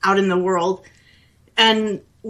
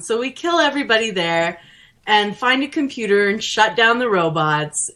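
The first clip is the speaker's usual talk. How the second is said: ordinary talk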